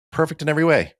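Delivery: high, loud enthusiastic verdict; mock rave. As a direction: gush over the movie. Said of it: "I was like".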